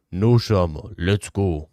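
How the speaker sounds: a hint of a cool fighter; aura voice. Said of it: "most aura voice possible".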